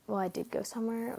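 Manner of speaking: usual speaking voice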